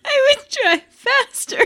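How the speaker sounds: high and loud, tearful laughter; crying with laughter. Real, not acted. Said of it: "I can't stop crying now".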